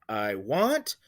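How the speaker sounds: "I want" with WANT leaned on; light I, strong drawn-out want; stress on want. Shown as a demonstration. Teacher pointing out the stressed syllable, stress on want. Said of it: "want is the stressed syllable".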